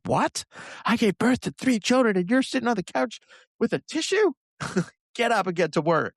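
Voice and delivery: high-pitched mock-scolding partner; ridiculing partner. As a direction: imitate an outraged partner, squeaking up very high. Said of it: "I will get ridiculed by my partner".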